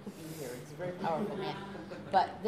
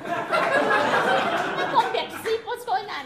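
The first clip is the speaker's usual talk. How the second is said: much higher, loud, slow panicked squeal; mock panic; 14 dB louder, much slower than usual. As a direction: squeal it like a terrified friend. Panicked reply to Dell.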